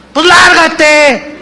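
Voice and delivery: a drawn-out, scornful brush-off; curt dismissal. Acted. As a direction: throw her out with contempt. Husband tells wife to leave.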